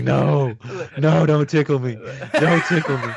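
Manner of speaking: high, giggling protest; being tickled. Retold or imitated. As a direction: squeal through laughter while tickled.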